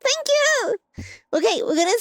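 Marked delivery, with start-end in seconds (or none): 0.0-0.8 high squealing thank yous; shrieking jackpot winner. Real, not acted. Subs arrive, spinning wheel.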